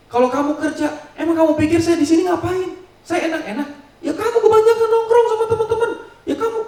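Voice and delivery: high, loud mocking shout with a bitter laugh; husband yelling. Husband mocking wife's nights out, high laughing shout. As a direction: sneer and yell, then laugh at her. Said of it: "Suaminya bilang".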